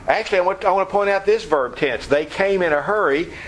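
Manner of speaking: ordinary delivery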